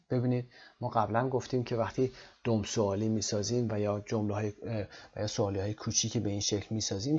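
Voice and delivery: ordinary talk, nothing marked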